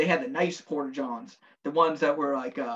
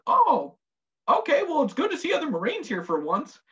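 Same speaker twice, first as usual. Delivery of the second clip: pleased corporal; 9 semitones higher than usual. Retold or imitated. Corporal voiced in story.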